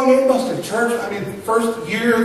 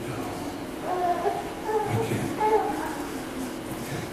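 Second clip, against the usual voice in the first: very soft, short replies with long pauses; hushed re-enactment of a painful call; subdued resignation; 11 semitones higher, 12 dB quieter, faster than usual. Retold or imitated. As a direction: barely voice each answer. Divorce call continues.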